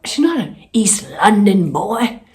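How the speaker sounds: put-on a country accent meant as East London; playful wrong-accent East London boy; mock country accent. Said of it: "that was a country accent for East London".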